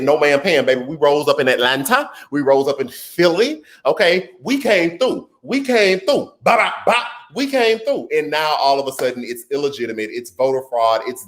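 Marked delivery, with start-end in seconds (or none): 0.0-8.1 higher, triumphant sassy retort; proud voters. Acted. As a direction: crow it like a victory chant.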